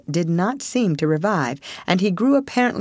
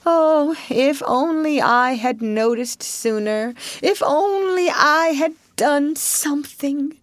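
higher hammed-up regret; imitating Rat's future remorse; mock lament; 10 semitones higher than usual. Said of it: "you may be saying to yourself".

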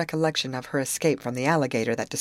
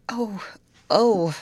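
mild frightened oh oh, rising then loud; alarmed cry; 5 semitones higher, 4 dB louder than usual. A narrator's reading. Grace cries out as the boat lurches to a halt, alarmed. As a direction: gasp out in alarm at the jolt. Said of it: "cried Grace".

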